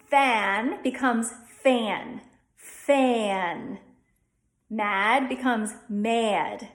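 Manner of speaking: "fan" and "mad" said slowly with an eh and a schwa; Delco fan and mad. Shown as a demonstration. Teacher shows "fan" and "mad" the Delco way. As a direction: stretch "fan" as feh-an. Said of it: "adding a schwa after that".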